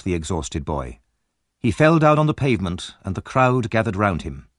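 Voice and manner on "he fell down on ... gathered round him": ordinary delivery, nothing marked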